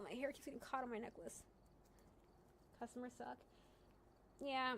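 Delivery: ordinary talk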